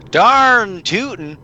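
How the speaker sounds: slow Southern drawl; slow Southern cowgirl accent. Acted. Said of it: "She says "darn tootin'".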